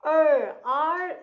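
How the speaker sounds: loud, drawn-out 'er, R'; R sound isolated. Shown as a demonstration. Teacher shows children the missing sound, loud 'er, R'. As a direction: hold 'er, R' to show the R sound. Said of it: "So R sound has to be there".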